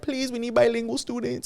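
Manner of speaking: high pleading voice; mock pleading. Mocking people who now plead for bilingual students. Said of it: "now a lot of people are like".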